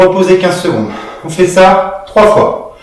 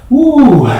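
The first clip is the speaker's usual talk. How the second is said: relieved exhale; 6 semitones higher, 3 dB louder than usual. Real, not acted. Exhale after last rep.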